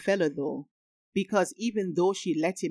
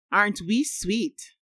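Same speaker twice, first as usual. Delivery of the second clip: mildly sarcastic, higher and slow; 5 semitones higher, 4 dB louder than usual. A narrator's reading. Friend teasing the affectionate couple, lightly sarcastic. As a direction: draw it out with a touch of mock sweetness. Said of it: "Janine said sarcastically".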